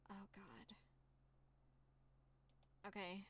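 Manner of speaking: breathy whisper of dismay; whispered dread. Real, not acted. Player facing a cruel choice, a horrified whisper. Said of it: "player dreading the choice".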